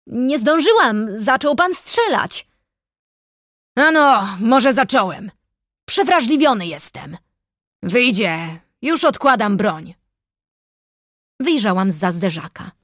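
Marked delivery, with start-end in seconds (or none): 0.1-2.5 high, slow calling out; shouted protest. A narrator's reading. Investigator blames forester for shooting first, calling back. 3.8-9.9 lightly voiced, raised-voice forester; forester calling out. A narrator's reading. Forester admits shooting and puts gun down, calling out. Forester calls back, standing down.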